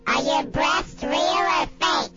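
ordinary talk, nothing marked

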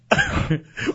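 laugh. Real, not acted. Host cracks up at gagging.

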